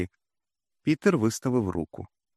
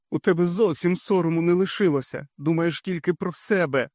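raised, higher accusing cry; shouting mother; 8 semitones higher than usual. A narrator's reading. Mother yelling at Frannie, high and raised. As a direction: hurl it at her daughter. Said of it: "закричала Карла".

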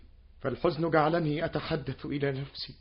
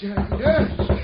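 louder alarmed cry; panicked exclamation; 4 semitones higher, 6 dB louder than usual. Acted. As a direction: burst out in dismay. Panic, door being forced. (صيحة ذعر مرتفعة)

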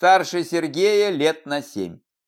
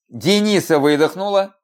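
ordinary delivery

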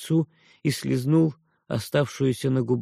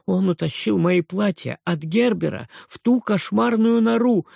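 aunt cries out; 7 semitones higher than usual. A narrator's reading. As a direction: shriek the complaint. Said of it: "вскрикнула тётя". (тётя вскрикивает, высоко и громко)

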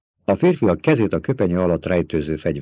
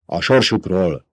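mild bark; 2 semitones higher, 5 dB louder than usual. A narrator's reading. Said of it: "förmet rá Havet".

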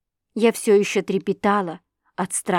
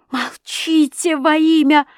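high, loud, drawn-out plea; desperate shout; 8 semitones higher, 5 dB louder, slower than usual. A narrator's reading. Girl implores husband to keep silent, desperate shout. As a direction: beg him loudly to stop.

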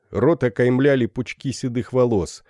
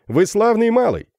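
высоко, громко, с восторгом; обрадованный капитан хвалит; радостно; 13 semitones higher, 5 dB louder than usual. Acted. Феб радуется монете. (восторженно)

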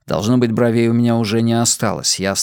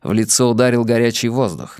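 ordinary delivery, nothing marked

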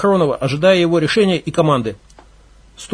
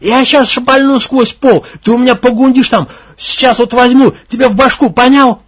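enraged leader at the cellar hatch; furious threat; 6 semitones higher, 6 dB louder than usual. Acted. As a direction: threaten to shoot through the floor. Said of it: "окончательно взбеленился".

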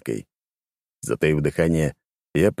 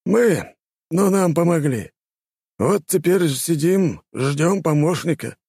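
high, slow storyteller voice; 11 semitones higher, slower than usual. Acted. Gorky answering.

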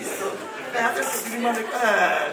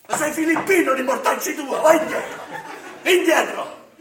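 loud, high hammed-up yell; mock furious shout; 7 semitones higher, 10 dB louder than usual. Santa driving the children back with a mock furious shout. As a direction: bark them back in fake rage.

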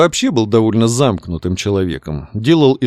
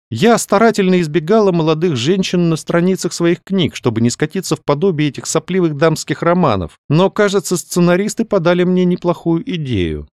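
higher-pitched lady musing aloud; lady novelist, thoughtful; 7 semitones higher than usual. A narrator's reading. Каролина tells the doctor about her novels, thoughtful. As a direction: think your new idea through in a lifted voice.

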